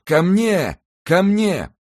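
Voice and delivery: drowning man calling out, loud and high; cry for help. Acted. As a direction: shout for help as you sink.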